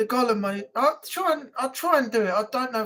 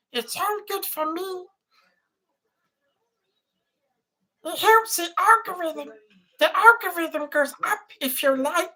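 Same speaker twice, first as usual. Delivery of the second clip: high-pitched helium voice; 7 semitones higher than usual. Shown as a demonstration. Streamer asking viewers to like and subscribe, helium voice. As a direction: talk as if you've sucked helium. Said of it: "With the helium one".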